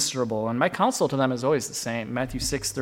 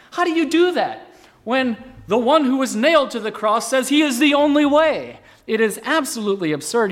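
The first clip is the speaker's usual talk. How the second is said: much higher and louder, soaring indignant cry; preaching shout; 10 semitones higher, 6 dB louder than usual. Real, not acted. Preacher to congregation on the cross, rising shout. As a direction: hurl the question at the congregation, full force. Preacher building to rhetorical peak.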